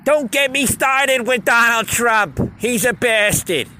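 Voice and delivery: high, loud, slow indignant rant; mock cranky oldster. Mocking older people acting like kids, high slow loud rant. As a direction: rant like a grumpy old man-child. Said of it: "acting like little children".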